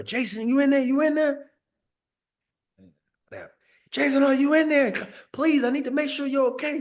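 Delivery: high, worried woman calling through the door; worried lady at the door. Retold or imitated.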